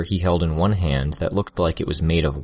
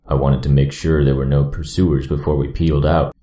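deep male voice; 3 semitones lower, 5 dB louder than usual. A narrator's reading. Gru voiced by narrator.

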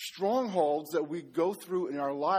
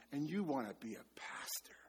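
a hushed, breathless whisper of an inner voice; 4 semitones lower, 11 dB quieter than usual. Retold or imitated. Inner voice mocking him on the sofa, soft whisper. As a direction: whisper it like a voice in your head. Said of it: "some voice, you know, saying".

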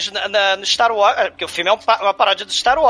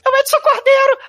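very high, louder eunuch voice; chipmunk voice; 4 dB louder than usual. Pretend. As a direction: squeal it high like a castrato. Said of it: "Deixa eu parecer castrado".

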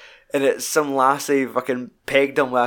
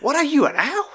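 high-pitched mocking retort; mock-outraged jeer; imitated scolding wife; 13 semitones higher than usual. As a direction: tear into him, voice pitched way up. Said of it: "the way she tears into him".

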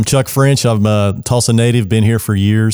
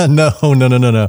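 laughing denial; 3 semitones higher than usual. Real, not acted. Laughing off Baywatch joke.

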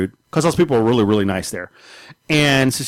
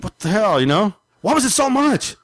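mock indignant; 9 semitones higher than usual. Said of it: "I was like".

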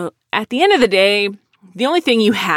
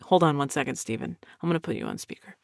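much softer, dropped low as if off the mic; quiet aside; 4 semitones lower, 10 dB quieter, much faster than usual. Retold or imitated. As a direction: pull back and murmur it aside. Boss aside before speakerphone.

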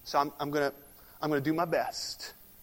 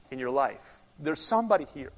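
usual speaking voice

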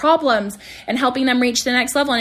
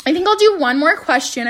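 ordinary delivery

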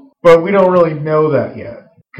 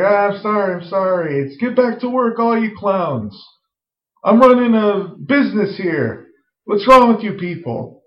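voiced exasperated dad; 7 semitones higher than usual. Owner scolding his staff, mock exasperated. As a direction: play the fed-up owner barking at his clowns. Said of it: "Timmy's like".

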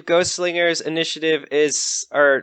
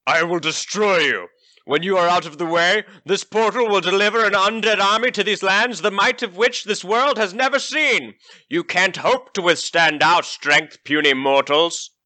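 undead knight; 4 semitones higher than usual. Acted. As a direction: threaten like an undead warlord. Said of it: "shrieks an inhuman shriek".

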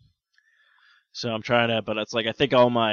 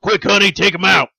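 loud, high hurried call to honey; urgent call; 6 semitones higher, 11 dB louder than usual. Acted. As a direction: shout it like an emergency.

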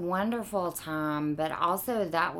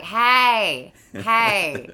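tired drawl call; 4 semitones higher, 9 dB louder than usual. Retold or imitated. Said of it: "I think I was like".

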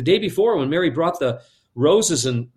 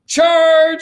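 high, loud drawn-out acted charge; yelled battle cry; 13 semitones higher, 8 dB louder than usual. Two children charging each other on the bridge, yelling. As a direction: bellow it like kids storming in. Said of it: "the two of them yell out".

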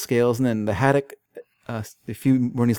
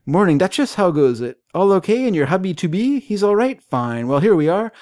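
hearty salesman; 7 semitones higher, 4 dB louder than usual. Retold or imitated. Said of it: "Jolyon Wag w-, rolls in".

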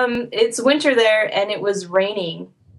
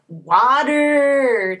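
exaggerated American "water", hard R drawn out; slow, higher-pitched parody of an American accent; mocking American accent; 5 semitones higher than usual. Australians making fun of American speech, mocking American accent. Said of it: "make fun of you and emphasize".

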